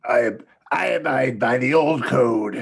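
loud, growly mock-British knight; Dennis Quaid's bad British accent. Co-host taking a turn at the knight's oath, hammy bad British accent. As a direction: overdo a poor British accent until it turns pirate. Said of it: "Dennis Quaid trying to do a British accent".

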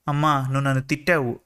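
usual speaking voice

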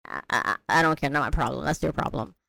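low, soft, can't-be-bothered shrug; dismissive. Retold or imitated. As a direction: toss it off like it's not your concern. Careless witch shrugging off harm.

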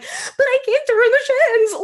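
very high whiny plea, breaking into laughter; laughing kid protest. Retold or imitated. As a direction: squeal it out giggling like a guilty kid. Kid protesting, laughing while retelling.

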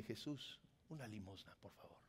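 low, fading, timid plea; meek begging. Acted. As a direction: beg softly, voice trailing away. Voices those settling for alms. (súplica tímida y apagada)

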